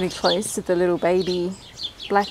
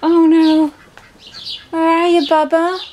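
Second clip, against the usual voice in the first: worried calling; 8 semitones higher, 9 dB louder than usual. Real, not acted. Calling the lost baby bird.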